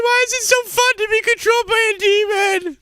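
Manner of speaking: high silly mocking voice; mock reply. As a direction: squeak it out in a mocking tone. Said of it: "And I was like".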